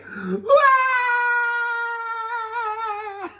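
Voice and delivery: a trainee monk bursting into a wail; wailing cry. Acted. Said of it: "He started crying".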